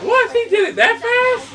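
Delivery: high, loud disbelief; shocked. Real, not acted. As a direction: blurt it out in shock. Shocked at game event.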